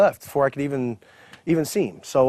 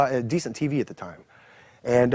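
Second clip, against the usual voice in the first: ordinary delivery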